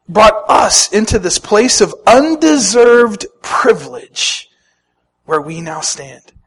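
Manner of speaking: usual speaking voice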